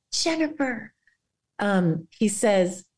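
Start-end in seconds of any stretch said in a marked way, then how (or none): 0.1-0.9 calling the name out higher with delight; exclaimed name. Retold or imitated. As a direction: call her name with joy. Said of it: "I see Jesus saying".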